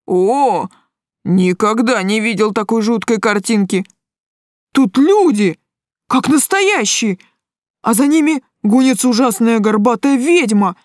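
cocky guy growing uneasy. A narrator's reading. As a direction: marvel with mounting alarm.

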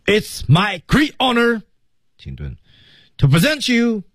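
teacher pacing demo; phrased with pauses. Shown as a demonstration. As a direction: break the line into deliberate chunks.

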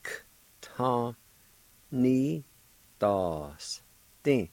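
"K Ta, ni, das, din" in separate sounds, K a bare click without a vowel; syllable by syllable. Shown as a demonstration. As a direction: isolate the K, then step slowly through "Ta, ni, das, din". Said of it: "the V sound will be silent".

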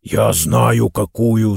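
usual speaking voice

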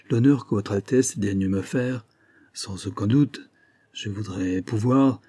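lent et hésitant, phrases inachevées; balbutiant. Acted. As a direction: cherche ses mots, embarrassé. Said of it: "reprit le major en balbutiant". (le major gêné répond au prince en balbutiant, lentement)

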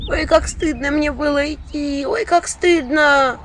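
high slow wailing village woman; slow high shamed lament; ashamed lament. Acted. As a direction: wail over the shame, slowly. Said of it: "выпучила глаза женщина".

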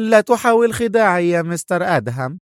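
high, scornful woman's voice; high-pitched mocking refusal; sarcastic cry. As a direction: scoff at him in a high voice. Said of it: "صاحت في سخرية".

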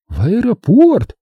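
high, slow, astonished echo; shocked cry. A narrator's reading. Startled passengers echo the destination in chorus, shocked. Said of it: "закричали они хором".

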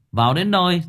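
plain reading voice

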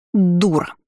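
hissed insult in place of a greeting; angry hiss. A narrator's reading. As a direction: spit it out as a hiss. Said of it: "прошипела вместо приветствия". (злое шипение)

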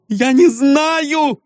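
slow, high anguished cry; high drawn-out outcry. Acted. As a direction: cry it out in despair. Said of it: "вскричала Эльза".

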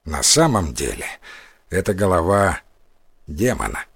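низко, с паузами, предостерегающе; понизь голос и предупреди с расстановкой; серьёзное предостережение. A narrator's reading. Священник предостерегает разбойника о демоне. (веское предостережение низким голосом)

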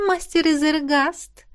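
high, slow, flirty chirp; cartoon princess. A narrator's reading. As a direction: coo his name flirtatiously. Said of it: "кокетливо прощебетала Мисси".

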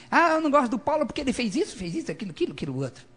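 high, rushed mock grumbling; hammed-up complaint, starting high and tumbling down; mock complaining. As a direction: imitate someone rattling off petty grievances. Voices a petty complainer.

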